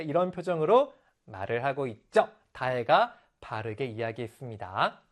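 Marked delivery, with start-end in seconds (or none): none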